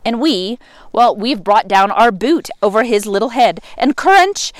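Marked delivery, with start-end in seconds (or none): 3.8-4.5 gleeful crunch. A narrator's reading. Boot crushing the serpent's head.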